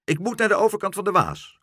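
high, loud, quick recital; squawking shout. A narrator's reading. Karel repeats meneer Pen's directions, squawking shout. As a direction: rattle it off in a bird squawk. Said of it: "riep Karel".

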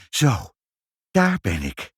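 lightly puffed arrival; a touch out of breath; panting. A narrator's reading. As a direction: say it as if just puffing in. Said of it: "hijgde meneer Sinkel".

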